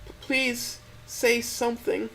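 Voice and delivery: put-on a heartbroken young woman asking for an answer; high trembling plea; Stella, pleading and tearful. A narrator's reading. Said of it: "as her voice answers".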